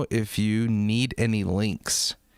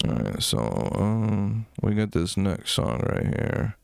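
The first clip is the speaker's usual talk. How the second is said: much lower, subdued voice; really quiet; 7 semitones lower than usual. Shown as a demonstration. Streamer showing chat how he goes low on long lives. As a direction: drop way down to save the voice. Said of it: "I'll be like this".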